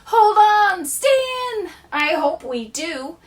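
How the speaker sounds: high, loud cry from the poem's characters. Acted. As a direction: call it out high and loud as they fly off. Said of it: "cried Ickle me".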